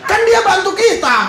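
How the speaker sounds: ordinary talk, nothing marked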